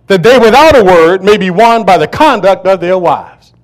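thundering sermon read; preaching at full force. Real, not acted. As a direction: proclaim it to the congregation. Preaching scripture loudly.

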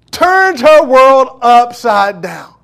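very slow, loud, high proclamation; preaching climax. Real, not acted. Preacher driving home the sermon point, loud and very slow. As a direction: lean on every word slowly.

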